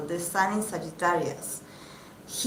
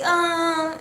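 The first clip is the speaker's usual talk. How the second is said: mock coo; 9 semitones higher, 4 dB louder than usual. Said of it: "they're like".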